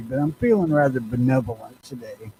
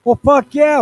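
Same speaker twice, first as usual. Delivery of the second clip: loud exclaim; 12 semitones higher, 8 dB louder than usual. Acted. Co-host mock agreement.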